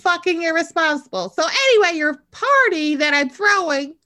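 loud, high-pitched telling-off; mock exasperated scolding. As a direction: scold her with hammed-up outrage. Acts out scolding Madison.

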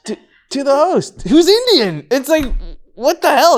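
what the hell, said in laughing disbelief; incredulous outrage. Real, not acted. Outraged about client's racism.

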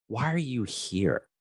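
a soft, probing question, taken slowly; quiet slow question. Retold or imitated. To the worried employee, boss asks softly and slowly. He looks at me and he goes.

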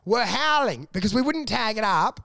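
ordinary delivery

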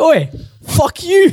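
very high, drawn-out mock retort; fighting back. Imitating the girl who fought back at Sam, defiant. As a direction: imitate a woman hurling abuse back, stretched out. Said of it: "fought back".

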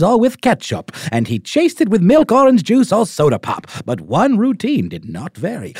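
put-on high, loud old newsreel mid-Atlantic accent. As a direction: read it like a 1930s mid-Atlantic announcer. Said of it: "like, mid-Atlantic accent".

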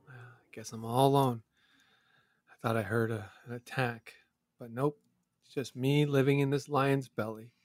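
softly, to himself; quiet. Acted. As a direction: mutter alone in the dark. Alone inside lion's belly.

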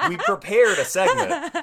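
laughing. Real, not acted. Laughing at the awkward setup.

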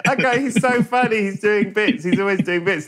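high, chirpy fan voice; mock bubbly crowd, pitched well up; gushing fans. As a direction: laugh it off like it's a comedy bit. Fans mocking dying Sam.

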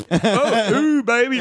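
hammed-up lusty moan; a silly mock climax voice. Goofy mock moaning in a fart bit.

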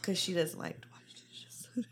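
unvoiced whisper, slow and soft; hushed, drawn-out whisper; whispered aside. Mimicking an aside about her.

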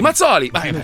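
normal talking voice

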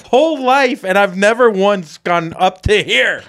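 high-pitched re-enacted yelling. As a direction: shout back at dad in exasperation. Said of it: "I raised my voice to him".

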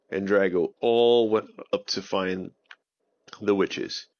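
ordinary delivery

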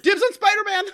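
high, loud, laughing call of dibs; excited dibs. Retold or imitated. Guard claiming the best call sign, laughing eager shout. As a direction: shout it like a kid claiming a prize. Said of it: "that guy was like".

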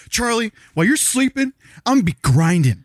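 cocky grinding boast aimed at Charlie; trash talk. Retold or imitated. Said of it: "You wanna see me be like".

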